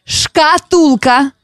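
very slow, over-articulated; slow exaggerated mouthing. Shown as a demonstration. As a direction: stretch every syllable for lip-reading. Mouthing the word for lip-reading.

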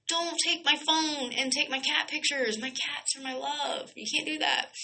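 high, soft, pouty whine; mock pleading. Cat lover begging phone thief to spare cat pictures, mock pleading.

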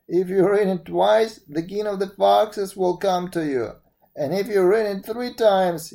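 put-on grand old woman making an offer; old fairy woman. Fairy explains the magic bell.

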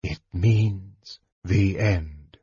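slow, measured pronouncement; stated gravely. A narrator's reading. Mage answering his alarmed brother, slow and measured. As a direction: state it slowly, with finality. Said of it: "Raistlin stated".

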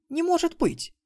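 high, loud blurted disbelief; выпалила. A narrator's reading. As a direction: blurt it out in a high, incredulous girl's voice. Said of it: "выпалила". (выпалить высоким голосом, не веря)

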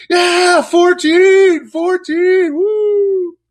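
very high, loud gleeful chant; kids cheering. Retold or imitated. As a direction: yell it like kids who just scored. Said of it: "the kids are all like".

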